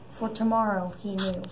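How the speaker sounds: ordinary delivery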